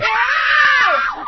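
loud high shriek of fright. Acted. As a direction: shriek in horror. Shriek at spider idea.

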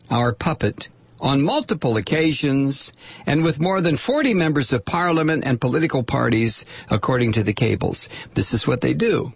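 normal talking voice

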